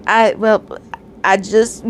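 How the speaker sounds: ordinary delivery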